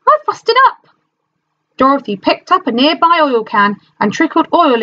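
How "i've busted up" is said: very high strained complaint; stuck tin man. A narrator's reading. Rusted Tin Man complaining to Dorothy, very high. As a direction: squeeze it out high and stiff.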